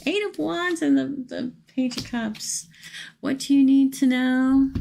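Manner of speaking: excited. Real, not acted. Cards drawn in excitement.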